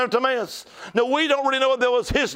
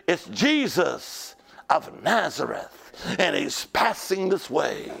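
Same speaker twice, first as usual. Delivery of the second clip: a low, slower passerby's voice; 6 semitones lower, 3 dB quieter, slower than usual. Acted. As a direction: drop low and slow to tell the beggar. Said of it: "And they said".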